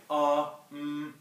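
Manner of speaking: a drawn-out hesitant "uh, mm" hum; demo filler sounds. Shown as a demonstration. Teacher to class, demonstrating filler sounds hesitantly. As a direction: show a filler, like you're searching for a word. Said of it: "could be fillers like".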